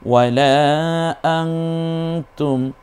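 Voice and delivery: ওয়ালা আংতুম chanted slowly, long লা and hummed ং; ikhfa recitation. Shown as a demonstration. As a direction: stretch লা, then hold the nasal in আংতুম. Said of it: "তখন ইকফা করে পড়তে হয়".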